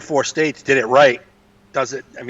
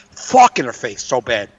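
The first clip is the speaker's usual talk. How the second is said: ordinary talk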